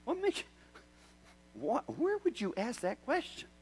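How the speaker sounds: high, startled question; half-laughing disbelief; surprised reply. Retold or imitated. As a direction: sound caught off guard. Retelling his own surprised reply.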